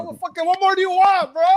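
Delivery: high-pitched, exasperated protest; mock exasperation. Real, not acted. Comedian to friends who clowned him, mock exasperation. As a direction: plead in playful outrage with your teasing friends.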